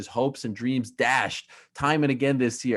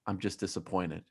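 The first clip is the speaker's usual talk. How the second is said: much lower, softly delivered quote; quoted disappointment; 8 semitones lower, 10 dB quieter than usual. Retold or imitated. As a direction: drop low and soft for the quoted letdown. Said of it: "he said".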